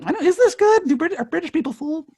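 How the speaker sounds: high-pitched worried inner voice; high, uncertain aside; mock doubt. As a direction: squeak out the doubt, high and small. Acted inner doubt about an accent.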